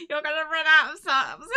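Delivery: rising, tearful plea through laughter; laughing protest. Real, not acted. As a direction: build to a loud, high laugh-cry. Protesting a flood of gifts.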